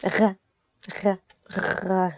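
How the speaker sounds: غ as a voiced gargle at the back of the throat; ghain sound. Shown as a demonstration. Teacher isolating the difficult ghain sound. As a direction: rasp the غ like a soft gargle. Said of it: "because of the غ".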